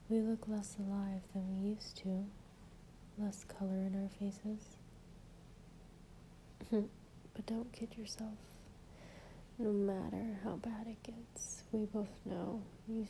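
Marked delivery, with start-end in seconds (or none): 4.8-7.3 soft breathy giggle; whispered giggle. Acted. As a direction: giggle under your breath. Giggle during whispered poem.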